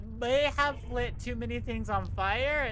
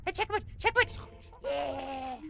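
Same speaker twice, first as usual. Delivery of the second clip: excited; 3 semitones higher than usual. Real, not acted. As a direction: yell it out in delight. Reaching a checkpoint, cheering.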